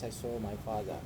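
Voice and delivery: ordinary delivery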